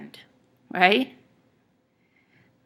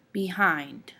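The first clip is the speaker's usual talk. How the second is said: "Behind" as be-HIND; quick light "Be", stronger "hind"; stress on second syllable; 4 dB quieter than usual. Shown as a demonstration. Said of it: "hind is stressed".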